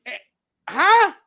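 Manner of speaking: high, loud challenging "huh?". Real, not acted. Pressing his friend on his argument, loud challenging huh. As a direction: demand an answer.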